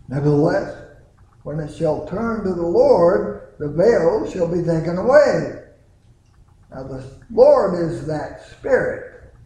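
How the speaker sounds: loud, driving scripture reading; fervent pulpit reading; preaching scripture. Acted. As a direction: proclaim the verses to the congregation. Reads scripture at full force.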